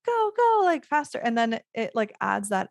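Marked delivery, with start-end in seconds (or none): 0.0-1.2 cheering them on. Retold or imitated. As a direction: hype them up like a countdown cheer. Urging couple to kiss faster.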